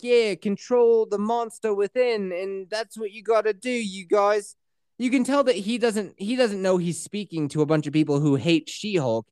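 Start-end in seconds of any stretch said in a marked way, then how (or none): 0.0-4.6 mock motivational speaker; Blonsky's motivational intro. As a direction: hype up the crowd like a paid speaker. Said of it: "He's like".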